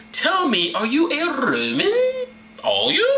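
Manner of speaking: high, drawn-out mock questioning; interrogating captain. Chief captain questioning Paul, theatrically inquisitive. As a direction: probe with exaggerated rising pitch. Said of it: "chief captain came up and said".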